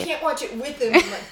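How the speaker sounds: ordinary talk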